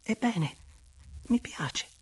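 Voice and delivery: high, airy, hesitant admission; bashful little confession to a lover; almost timid. Acted. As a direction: offer it shyly, high-pitched, with a pause before the end. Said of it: "quasi timida".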